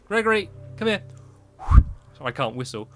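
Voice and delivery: high, loud pet call; sing-song call to a dog; calling a lost pet. Real, not acted.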